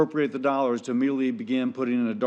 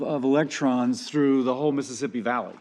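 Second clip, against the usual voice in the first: ordinary talk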